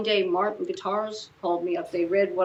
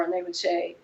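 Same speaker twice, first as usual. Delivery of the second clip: normal talking voice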